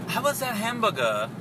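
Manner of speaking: put-on Southern Belle accent. Society lady asking about his meal, imitated Southern Belle accent. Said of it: "I can't do the accent just right".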